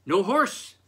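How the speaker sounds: plain reading voice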